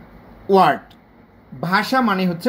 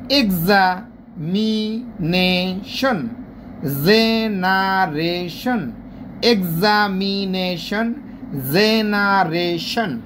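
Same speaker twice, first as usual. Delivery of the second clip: "Examination, generation" very slowly, syllable by syllable; split into syllables; much slower than usual. Shown as a demonstration. Teacher demonstrating polysyllables, "examination, generation" slowly split up. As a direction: chant out each syllable of "examination" and "generation", long and even. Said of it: "syllable ভাগ করে ভাগ করে".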